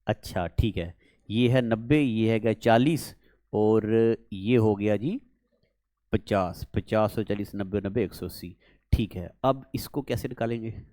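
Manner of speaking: low, quiet working-out to oneself; thinking aloud. Real, not acted. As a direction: count it through softly, in a low voice. Working out the sum alone. (धीमे स्वर में खुद से हिसाब)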